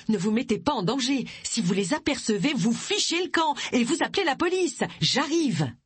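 high, urgent phone instructions; pressing, high-pitched orders; urgent warning. Acted. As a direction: warn them firmly, pitch climbing.

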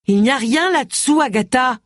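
calling out. A narrator's reading. Woman reporting to Agatha from the bushes, calling out. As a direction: call it out without a full shout. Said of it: "cria-t-elle".

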